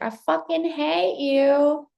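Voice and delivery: high, loud, slow hateful drawl; mock hater. Mimicking a hateful commenter for the algorithm, slow and loud. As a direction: stretch it out like a spiteful troll.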